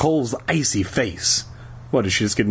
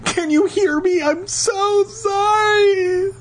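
very high, over-the-top wail; crying out; slower than usual. A narrator's reading. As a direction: wail it shrieking, last words stretched long. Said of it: "Lauren cried".

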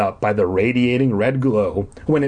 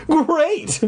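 very high, drawn-out happy cry; elated high exclamation; cried out. A narrator's reading. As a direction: cry it out with delight. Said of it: "I cried out loud".

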